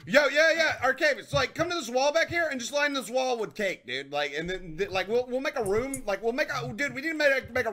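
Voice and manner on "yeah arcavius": usual speaking voice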